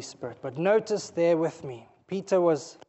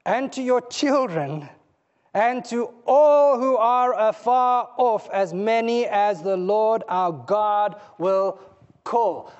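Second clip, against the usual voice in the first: slow, louder preaching build; fervent preaching; 3 semitones higher, 6 dB louder, slower than usual. Acted. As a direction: call it out over the congregation, slowly. Preaching at full force.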